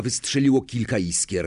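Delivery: ordinary delivery, nothing marked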